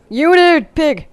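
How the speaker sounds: loud, high play-shout; mock battle call. Player calls out to a pig in the game, mock shout. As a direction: holler the name out in mock alarm.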